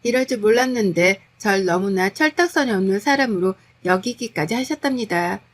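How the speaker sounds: plain reading voice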